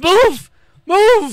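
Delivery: shrill high yell; shouting. Real, not acted. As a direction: scream it at them. Yelling at a character in game.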